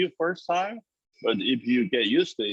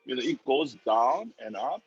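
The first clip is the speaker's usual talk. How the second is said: usual speaking voice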